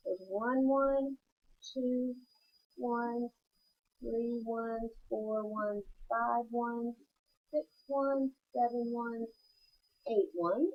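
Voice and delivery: soft, quiet counting of ones; counting under breath. Shown as a demonstration. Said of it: "So if you would whisper count".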